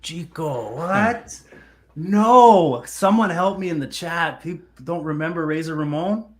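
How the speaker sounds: playful disbelief. Real, not acted. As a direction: appeal in loud, high mock shock. Disbelief that co-host doesn't know wrestler.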